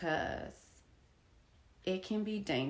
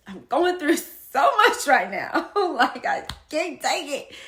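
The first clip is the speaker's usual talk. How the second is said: louder, laughing through the words; 6 semitones higher, 13 dB louder than usual. Real, not acted. Laughing while telling retold plea.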